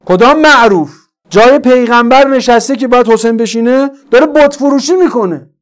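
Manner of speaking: loud, high, outraged sermon; full-force preaching. Real, not acted. Preacher denouncing the usurper of the Prophet's seat, full-force preaching. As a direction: thunder the accusation from the pulpit.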